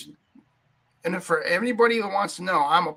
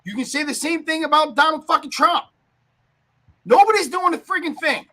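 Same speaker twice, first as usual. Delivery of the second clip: rising, louder furious rant; angry rant; 5 semitones higher, 5 dB louder than usual. Real, not acted. Host rants to his co-host about gun laws, furious. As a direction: build to a real shout. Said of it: "And I'm pissed".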